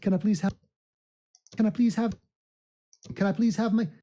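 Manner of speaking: put-on high, cheeky London-accent plea. Streamer asking for subscribers, put-on London accent. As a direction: call out like a Londoner begging.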